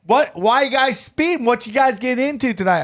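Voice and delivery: quick questioning as a mock cop; cop at a traffic stop. Cop pulls the comics over.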